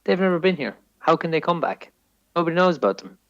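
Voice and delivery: ordinary delivery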